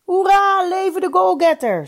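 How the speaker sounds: high, loud hurrah; crowd cheer. A narrator's reading. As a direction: cheer like a whole hall shouting. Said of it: "schreeuwde de hele zaal".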